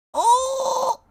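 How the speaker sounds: very high, loud delighted shriek; sudden high squeal of delight; excited squeal. Real, not acted. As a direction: squeal at the cute puppy. Seeing puppy on stream.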